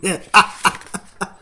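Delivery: loud gleeful villain laugh; evil cackle. A narrator's reading. The Grinch laughs at the crying Whos, loud and wicked. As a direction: cackle with delight.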